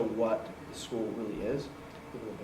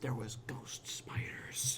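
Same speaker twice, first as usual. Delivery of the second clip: slow whispered spooky line; 9 dB quieter, much slower than usual. Pretend. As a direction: drag out the whisper.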